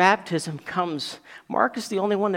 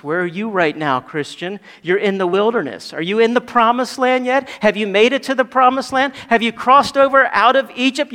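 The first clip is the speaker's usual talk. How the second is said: loud, higher-pitched volley of questions; full-force preaching; 6 semitones higher, 8 dB louder than usual. Real, not acted. Preacher pressing the congregation on their wilderness struggle, forceful preaching. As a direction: preach it hard, driving every point home.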